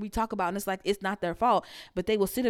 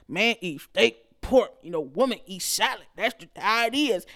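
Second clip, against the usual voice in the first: cartoon macho guy; 4 dB louder than usual. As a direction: drag out the words like a simple-minded macho man. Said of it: "That cartoon skit".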